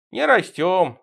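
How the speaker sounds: mildly hammed-up crying; tearful. A narrator's reading. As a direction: whine it as if crying. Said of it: "заплакали мои друзья".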